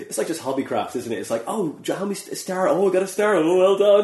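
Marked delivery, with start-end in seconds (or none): 1.4-4.0 put-on high, gushing child getting a star; mock excited child. Mocking a child thrilled with a gold star sticker. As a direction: squeal over a gold star like a kid.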